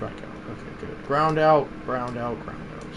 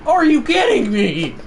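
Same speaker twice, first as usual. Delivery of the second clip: usual speaking voice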